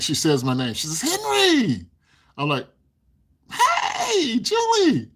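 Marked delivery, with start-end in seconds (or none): 1.0-1.9 high, loud call across the room. Retold or imitated. Girl calls his name across store. 3.5-5.1 high, loud cheery shout; greeting a friend by name, excited shout. Retold or imitated. Greeting called back excitedly.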